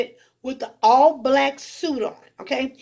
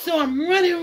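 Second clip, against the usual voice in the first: usual speaking voice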